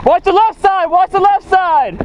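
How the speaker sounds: high, urgent warning call; urgent callout. Real, not acted. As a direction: shout the warning to teammates. Calling warnings in airsoft game.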